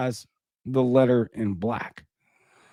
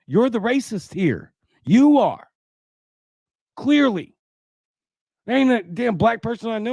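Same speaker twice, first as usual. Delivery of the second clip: heated accusation; 8 semitones higher than usual. Real, not acted. Host accusing the article writer.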